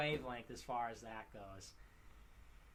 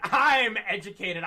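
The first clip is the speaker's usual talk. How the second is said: laughing mockery; 5 semitones higher, 12 dB louder than usual. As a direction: laugh through the snob line, louder. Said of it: "that haughty".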